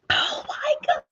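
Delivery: breathless high gasp; overwhelmed whispered gasp; stunned and overcome. Real, not acted. Reacting to surprise announcement.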